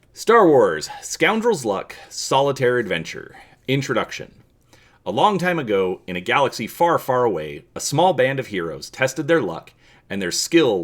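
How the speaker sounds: loud, grand story-narrator voice; big, booming storybook narration; dramatic reading. Acted. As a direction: read it like an epic opening crawl. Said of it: "dramatic reading of".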